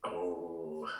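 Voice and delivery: ordinary talk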